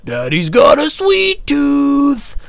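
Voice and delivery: high, very slow screech; stretched falsetto caricature; morphed creepy daddy voice. Retold or imitated. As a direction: draw it out like a movie monster. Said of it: "I morphed it into".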